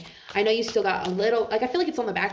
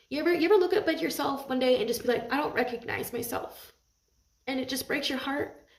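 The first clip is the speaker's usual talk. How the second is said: getting emotional; 6 semitones higher than usual. Real, not acted. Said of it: "I just got so emotional".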